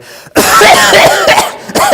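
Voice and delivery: loud, open, uncovered cough. Shown as a demonstration. Demonstrating in the elevator, a loud uncovered cough. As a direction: hack out one big loud cough. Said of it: "I started coughing really loud".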